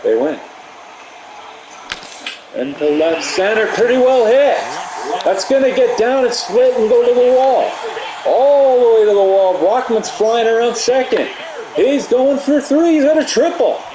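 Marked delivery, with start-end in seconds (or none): 2.5-13.8 high, loud excited sports call; live play-by-play. Real, not acted. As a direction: call the hit as it races to the wall.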